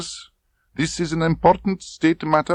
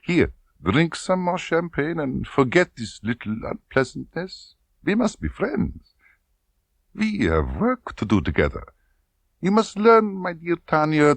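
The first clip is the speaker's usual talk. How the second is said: oily and smooth; 12 semitones higher than usual. Acted. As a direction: wheedle her like a false friend. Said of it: "The voice became oily and smooth".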